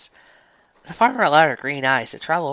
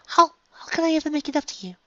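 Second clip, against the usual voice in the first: high, pleading posh voice; Rarity pleading. A narrator's reading. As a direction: beg to make amends.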